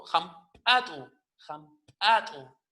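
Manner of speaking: "jampatu" with a popped ejective P; slow "jampatu", hard glottal pop on the P; glottalized P. Shown as a demonstration. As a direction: build lip pressure, then pop the P in "jampatu". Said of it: "una P glotalizada".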